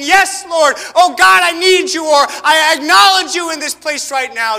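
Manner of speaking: loud, high praying out; a worshipper crying out to God, loud and high; fervent prayer cry. Acted.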